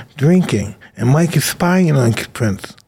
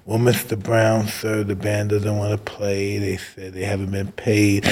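much softer, slow and careful; timid report; 6 semitones lower, 6 dB quieter than usual. Retold or imitated. [name] nervously telling [name] the band is unpaid, softly. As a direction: tiptoe the bad news to the boss.

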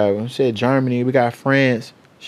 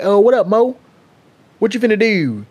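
higher-pitched imitation of a Baltimore buddy; playful Baltimore accent, pitched up; 7 semitones higher than usual. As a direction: mimic a Baltimore friend's greeting. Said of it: "He was from Baltimore".

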